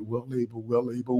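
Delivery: hushed, soft declaration; quiet voice. Shown as a demonstration. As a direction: say it softly to yourself, very low. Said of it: "You may have to say it in your quiet voice".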